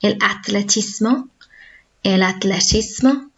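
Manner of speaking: atletismo with a plain t, no th; Spanish el atletismo. Shown as a demonstration. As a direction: say atletismo with a hard t. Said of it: "don't say el ath, 'cause there's no H".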